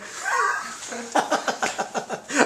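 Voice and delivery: long, high-pitched burst of laughter; real laugh. Real, not acted. Host joking with guest about drinking a bottle, laughing. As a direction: laugh out freely, high and breathless.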